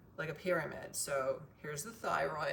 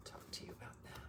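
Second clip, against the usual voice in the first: whispering; 17 dB quieter, faster than usual. Real, not acted. Whispered aside.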